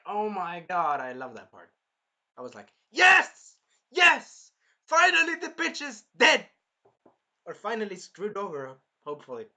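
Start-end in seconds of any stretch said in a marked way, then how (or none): 2.9-6.5 high, very loud cheering; screaming in triumph. Retold or imitated. Fan reliving the villain's death, shouted triumph. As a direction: shout it in victory. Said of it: "I was screaming in my".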